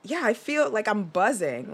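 usual speaking voice

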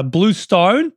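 slow, questioning Australian "Blue Stone"; attempted Australian accent. Shown as a demonstration. [name] asked to say Blue Stone, hesitant Australian accent. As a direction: try it slowly in Australian, unsure. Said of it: "with an Australian accent, [name]".

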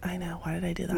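whispered regret to herself; sheepish whispered aside about her own choice. Real, not acted. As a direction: breathe it out without voice.

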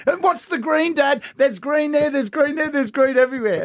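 put-on high childish retort; imitating daughter. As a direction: argue like a cheeky kid. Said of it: "daughter's retort in story".

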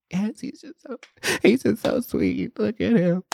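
high, squeaky tearful voice; mock-weepy gushing; retold crying. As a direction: re-enact being choked up with crying, pitch way up. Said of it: "And I started crying".

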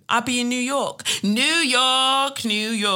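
high, loud and thrilled, building up to a sing-song; bursting to share the big trip news; excited New York. Real, not acted. Host telling listeners about her New York trip, excited.

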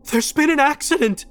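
high-pitched, distressed outcry; frantic plea. A narrator's reading. Distraught woman telling a driver of an accident, frantic. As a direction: cry it out urgently, very high.

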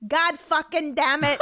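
high, loud imitation of a toddler; mock toddler swearing, laughing through it. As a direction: imitate a cursing two-year-old. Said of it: "he goes".

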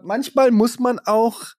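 put-on slow, high little kid voice; child's voice. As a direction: talk like a small child. Child's reply quoted.

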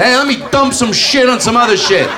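hammed-up careless cook, rising high at the end; slowing into a shouted, mocking finish; mocking lazy cook. Comic mocking a potluck guest's recipe, hammed-up careless cook voice.

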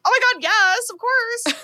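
high, bright-eyed eager acceptance, pitch way up; squealing mock-eager yes; gushing yes. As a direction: gush like someone thrilled at a free lunch. Candy gushes at lunch offer.

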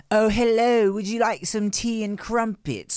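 high, mock-posh British queen voice; posh British accent. Shown as a demonstration. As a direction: put on an over-the-top posh English accent. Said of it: "immediately do a posh accent".

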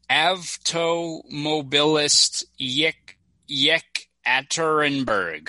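Avtomobilist Yekaterinburg spelled out slowly, syllable by syllable; slow stumbling attempt at a Russian name; careful sounding out. Real, not acted. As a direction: struggle through Yekaterinburg slowly. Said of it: "oh, Jesus".

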